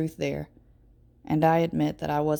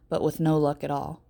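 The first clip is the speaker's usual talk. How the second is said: ordinary delivery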